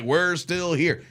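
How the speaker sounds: quoted defiant catchphrase. Retold or imitated. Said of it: "I almost feel like Tom Brady".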